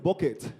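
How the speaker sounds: "bucket" said as "baw-ket"; Nigerian pronunciation. Shown as a demonstration. Comedian shows Kenyans the Nigerian way, "bucket" as "baw-ket". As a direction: open o and full "ket" in "bucket". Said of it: "in Nigeria we call it".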